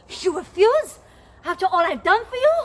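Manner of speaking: high, loud and fast protest; crying out in hurt. Acted. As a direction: fling it out, betrayed. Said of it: "cried Antonio".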